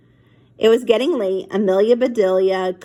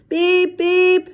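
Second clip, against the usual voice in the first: high clipped beep beep; toy horn beeps; 10 semitones higher, 4 dB louder than usual. Acted. Monkey honking the toy horn in the garage, high beeps. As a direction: chirp it like a little toy horn.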